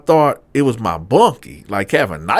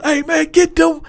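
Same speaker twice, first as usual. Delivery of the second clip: high, loud egging-on shout; mob shout; 5 dB louder than usual. Retold or imitated. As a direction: rile them up, yelling. Inmates banging on doors.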